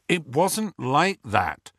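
slow, tight, through gritted teeth. Acted. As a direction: bite down on pain through teeth. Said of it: "said Harry through gritted teeth".

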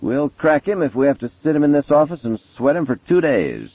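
high, emphatic vow; determined detective. A narrator's reading. Mason vowing to break Kemp, high and emphatic.

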